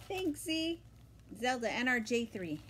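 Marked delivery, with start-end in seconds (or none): none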